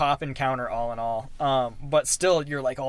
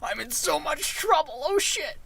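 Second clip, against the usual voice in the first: high mock-panicked squeal; hammed-up kid caught in trouble; 13 semitones higher than usual. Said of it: "heart in your throat".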